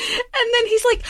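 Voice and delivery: usual speaking voice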